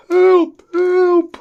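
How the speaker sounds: high, loud cry for help; screaming for help. Retold or imitated. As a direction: scream at the top of your lungs. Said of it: "start screaming at the top of my lungs".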